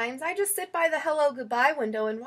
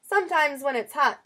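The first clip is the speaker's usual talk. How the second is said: ordinary delivery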